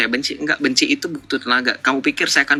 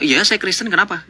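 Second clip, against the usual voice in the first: mock-proud, a little higher, chin up; proud answer; 8 semitones higher than usual. Streamer imitating a proud believer, proud and a little higher. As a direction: answer proudly, like you're glad to be asked. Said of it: "Saya kan bangga".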